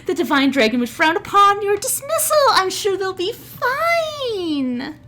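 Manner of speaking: very high, sweet scolding orc lady; orcish woman. Acted. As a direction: chide your friends in a lilting, sky-high voice. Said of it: "And the orcish woman goes".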